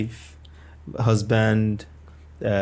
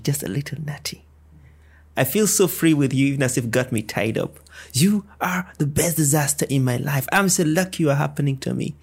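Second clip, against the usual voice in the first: poem recitation; 2 semitones higher than usual. Acted.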